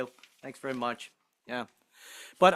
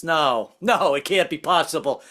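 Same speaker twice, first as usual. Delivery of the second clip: usual speaking voice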